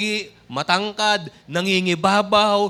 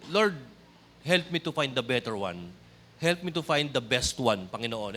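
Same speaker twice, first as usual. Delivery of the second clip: low, soft personal plea to God; retold prayer, hushed and low; quiet prayer; 6 semitones lower, 8 dB quieter than usual. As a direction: pray softly, as if privately. Retells own private prayer to God.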